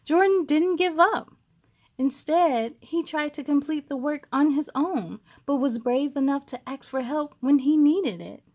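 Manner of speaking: storybook reading. A narrator's reading. Reading a picture book aloud to young viewers, lively storytelling. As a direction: read it to a group of kids.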